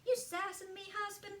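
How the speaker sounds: high-pitched sassy Southern newlywed wife; amateur Southern accent, teasingly scolding a husband. Acted. Sassy newlywed wife bickering with her husband, Southern accent. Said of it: "this kind of southern accent".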